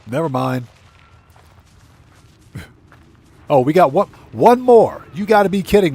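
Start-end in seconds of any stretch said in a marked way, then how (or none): 3.5-5.9 loud, high disbelief; exasperated surprise. Real, not acted. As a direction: burst out in disbelief. Another item appears.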